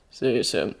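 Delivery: low, soft man's voice; Bucky, understated. A narrator's reading. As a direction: sign off quietly, pitched well down. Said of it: "Bucky says".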